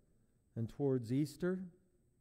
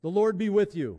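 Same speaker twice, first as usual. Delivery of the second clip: loud, raised liturgical call; 7 semitones higher, 12 dB louder than usual. Acted. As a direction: call out to the congregation.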